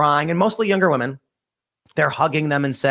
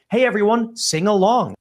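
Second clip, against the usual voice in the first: higher-pitched imitation of a chirpy organizer; put-on sing-along invitation, pitched up; mock rallying call; 6 semitones higher than usual. Organizer to arrested students in jail, mock rallying call.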